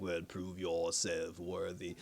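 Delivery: very low and slow godlike command; slow, deep divine challenge; totem god voice. Retold or imitated. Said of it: "the totem voice god even said".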